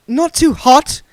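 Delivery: high, fussy genteel voice; prissy old vagrant, fussing; duck man voice. A narrator's reading.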